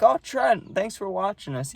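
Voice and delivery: high, singled-out taunt from teammates; mock teasing. Teammates ribbing the benched catcher, mock sweet teasing. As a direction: put on a high voice to needle him. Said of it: "they're messing with me".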